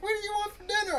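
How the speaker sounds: very high sweet falsetto; imitating his girlfriend. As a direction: squeak it like his girl on the phone. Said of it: "She's like".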